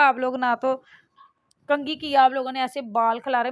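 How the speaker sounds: loud retold scolding of messy-haired kids; mock scolding. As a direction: tell the kids off, hammed up.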